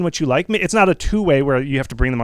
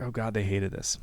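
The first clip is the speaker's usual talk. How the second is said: dejected; 5 semitones lower, 11 dB quieter than usual. Retold or imitated. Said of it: "they're like".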